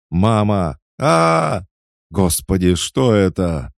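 high, loud cries of terror; panicked screaming. A narrator's reading. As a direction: cry out in horror at the unseen thing. Men scream as monster attacks.

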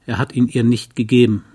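ordinary delivery

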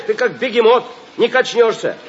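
normal talking voice

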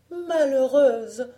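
loud, high, drawn-out exclamation; reproachful cry. A narrator's reading. Mother to her proud daughter, crying out in reproach. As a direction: cry out slowly in alarmed reproach. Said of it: "s'écria Marie-Jeanne".

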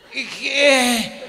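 high, loud bewildered what-what; tripping over his own words; panicked double take; startled stammer. Retold or imitated. Husband confused, stammering.

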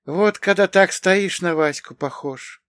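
high-voiced woman, musing; high, emphatic remark from an aunt; appraising huff. A narrator's reading. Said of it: "хмыкнула она".

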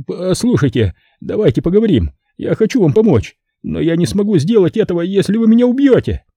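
high, frantic begging pimp voice; a terrified bar owner offering all his money; panicked pimp pleading. Acted. Terrified Simon begging the killer to take the money, high and frantic.

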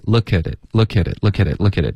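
"look at it" linked, the k very soft but not a g; light k in "look", gliding straight into "at it". Shown as a demonstration. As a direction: repeat "look at it" as one run, barely releasing the k. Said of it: "这个科特别软".

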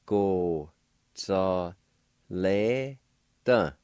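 Go, za, le, da said slowly, syllable by syllable; careful Cherokee pronunciation. Shown as a demonstration. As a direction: sound out Go, za, le, da with a pause after each. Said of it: "It's pronounced like this".

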